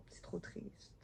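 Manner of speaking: soft sad whisper; a quiet whispered aside. Real, not acted. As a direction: breathe it out, moved. Whispered aside about sad story.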